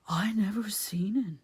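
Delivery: slow, whispery dialect voice; simple man, timidly denying it; frightened simpleton Ben. A narrator's reading.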